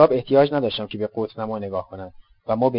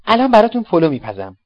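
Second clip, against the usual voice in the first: higher, louder jolly promise; cheerful offer; 7 semitones higher, 7 dB louder than usual. Acted. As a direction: announce dinner happily. (پیشنهاد شاد و بلند)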